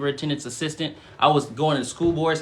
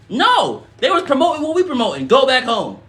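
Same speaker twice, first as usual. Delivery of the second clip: much higher and louder, fervent; fired-up preaching; 10 semitones higher, 7 dB louder than usual. Real, not acted. Streamer preaches passionately to viewers about spiritual teachers. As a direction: preach it with rising fire.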